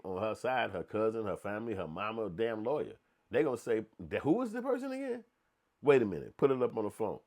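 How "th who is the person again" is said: high squeaky puzzled question; mock confused question, very high; mock puzzled relative. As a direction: play a confused relative squinting at the tape. Mock family asking who.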